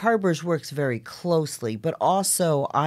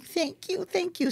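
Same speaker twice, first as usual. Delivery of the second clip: grateful thanks; 11 semitones higher, 10 dB quieter, slower than usual. Retold or imitated. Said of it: "they was like".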